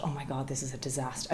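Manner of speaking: ordinary talk, nothing marked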